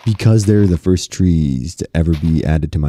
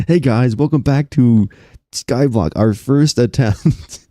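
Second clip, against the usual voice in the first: stream intro; 5 semitones higher, slower than usual. Shown as a demonstration. Opening the stream to viewers with an upbeat announcer intro. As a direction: sell it like a YouTube opener. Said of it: "Doing the intro is crazy".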